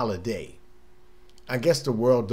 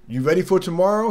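higher, faster eager customer asking; eager question; 5 semitones higher, 5 dB louder, faster than usual. Retold or imitated. Customer at work asking about Christmas, eager and quick. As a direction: toss it out quick and bright.

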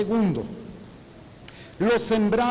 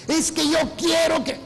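insistencia de feligrés; 9 semitones higher, 3 dB louder than usual. Retold or imitated.

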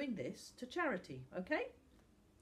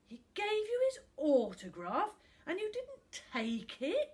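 loud, high-pitched disbelief; shocked; 12 semitones higher, 8 dB louder than usual. A narrator's reading. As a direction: ask it aghast, voice leaping up.

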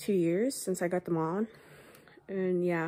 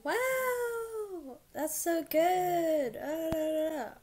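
mock impressed friend; 9 semitones higher, much slower than usual. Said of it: "they're gonna be like".

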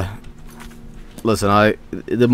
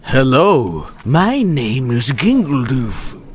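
slow put-on villain voice, building to a shout on "die"; menacing NPC; slower than usual. Gamer imagining a lurking NPC, slow menacing villain voice. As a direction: creep up then strike. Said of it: "an N P C behind me to be like".

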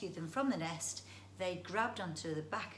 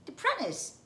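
very high, loud questioning squeak; squeaky creature repeat; 12 semitones higher, 8 dB louder than usual. Acted. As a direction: squeak the word like a puzzled little beast.

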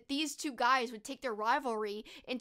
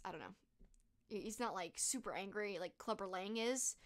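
ordinary talk, nothing marked

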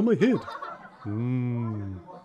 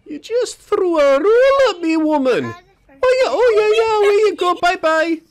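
much higher and louder yelps; indignant squeal; 8 dB louder than usual. Acted. The animal yelps at the woman who threw a roll, much higher and louder.

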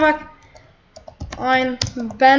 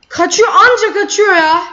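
higher-pitched, exasperated complaint; real frustration; 4 semitones higher, 4 dB louder than usual. Real, not acted. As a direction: complain heatedly about the runaway. Beschwert sich über fliehenden Gegner.

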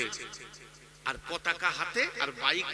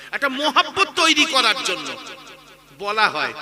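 high, loud burst peaking mid-phrase; emphatic preaching; 6 semitones higher, 10 dB louder than usual. Real, not acted. Preacher explaining the purpose of salam, loud emphasis. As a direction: drive the point home with a raised voice.

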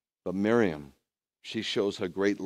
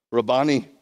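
loud acted cry of recognition; 3 semitones higher, 9 dB louder than usual. Miriam recognizes her teacher, crying out. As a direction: cry out the name, much louder. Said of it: "she cried out to him in Hebrew".

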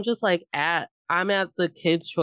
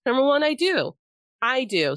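defiant; 7 semitones higher than usual. Real, not acted. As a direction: insist on it, chin up. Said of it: "defiant insistence".

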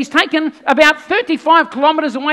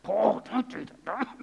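slow, soft anxious plea; pleading with Paul; 6 semitones lower, 13 dB quieter than usual. Retold or imitated. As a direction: gently hold him back. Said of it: "tried to talk Paul out of it and said".

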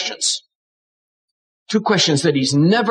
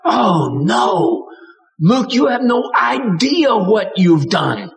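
grieving God; 5 semitones higher, slower than usual. Shown as a demonstration. God grieving to Luke over unleashed hell, anguished and slow. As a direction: cry out slowly in anguish. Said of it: "God grieved".

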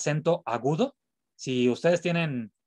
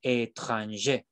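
"étranger" slowly, stress landing on the last syllable; stress on final E; 4 semitones lower, much slower than usual. Shown as a demonstration. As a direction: draw out "étranger" toward its final e. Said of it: "el acento va a recaer en la E final". ("étranger" lento, con acento en la E final)

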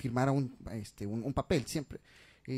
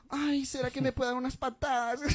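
effeminate customer; 10 semitones higher than usual. Retold or imitated. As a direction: swoon over the boots. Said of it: "medias afeminadas".

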